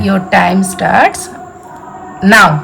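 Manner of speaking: dramatic countdown announcer. Pretend. Starting the challenge timer dramatically.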